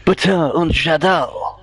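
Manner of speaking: put-on careful German, avoiding a comedy accent; attempted German accent. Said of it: "not to do a comedy German accent".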